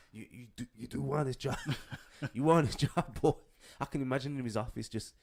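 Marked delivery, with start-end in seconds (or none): 0.1-3.3 put-on American boss, getting higher and louder; hammed-up bullying boss impression, broken by laughs; Vince. Boss asking if you want this job, impression. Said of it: "That's always the thing of Vince".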